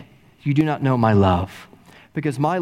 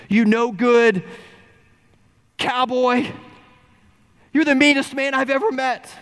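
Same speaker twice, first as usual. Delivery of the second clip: put-on a boy finally shouting back; furious outburst; 9 semitones higher than usual. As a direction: spit it at the man who mocked you. Stuttering boy explodes at rancher.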